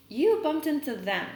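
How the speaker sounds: mother snapping at a rude neighbour; snapped retort. Acted. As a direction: snap it back at the neighbour. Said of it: "my mother snapped".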